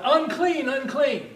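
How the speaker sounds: high, loud leper's warning cry. Acted. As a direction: call out to keep people away. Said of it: "required to cry out".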